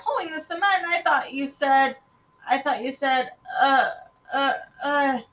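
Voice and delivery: put-on high, faltering boy's voice; baffled child. Boy who misheard his sister at breakfast, baffled and stammering. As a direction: start incredulous, then stumble for words.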